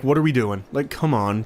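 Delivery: ordinary delivery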